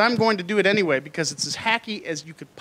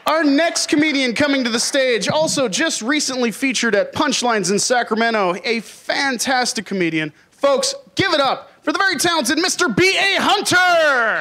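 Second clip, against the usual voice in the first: announcer intro; 6 semitones higher than usual. Real, not acted. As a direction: hype the crowd for the next act. Host introduces next comedian.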